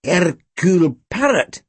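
slow, drawn-out mutter; amused, lingering repeat of the name. A narrator's reading. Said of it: "he muttered".

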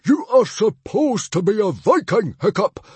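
high, loud reproach; fuming. Acted. Father lecturing his Viking son, fuming. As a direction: thunder the reproach. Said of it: "fumed Stoic the Vast".

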